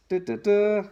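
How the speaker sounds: high, loud drumroll da da da; mock fanfare. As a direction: build the big reveal. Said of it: "reveal that the master reader is".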